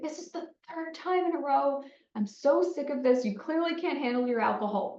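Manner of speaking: fed-up parent storming in, voice pitched high; angry confrontation. Acted. Parent storming into the bathroom at a drunk kid, angry scolding. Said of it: "storm into that bathroom and say".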